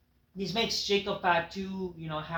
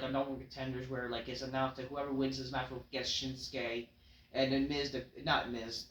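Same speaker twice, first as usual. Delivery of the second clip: ordinary talk, nothing marked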